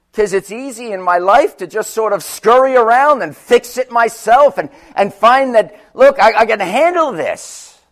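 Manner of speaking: full-force sermon climb; rising preaching. Acted. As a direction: preach it with mounting power.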